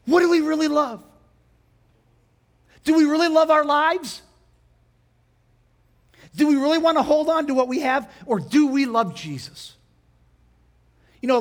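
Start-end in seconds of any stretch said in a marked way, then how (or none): 0.1-1.0 higher and louder, pressing the question; preaching loud. Real, not acted. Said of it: "Can I just capsulize it". 2.8-9.8 higher and louder, pleading challenge; preacher challenging his flock, loud; full-force preaching. Real, not acted. Preacher pressing the congregation.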